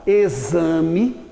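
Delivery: "exame" with the X voiced as a Z; X pronounced as Z. Shown as a demonstration. Teacher giving an example of X as Z, "exame" said with a Z sound. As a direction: say "exame" slowly enough to hear Z for the X. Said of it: "O X pode ter o som de Z".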